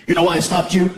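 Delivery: low-pitched mean cop question. Acted. Cop questioning a stopped driver, mean and low. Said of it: "the cop came to the car all mean".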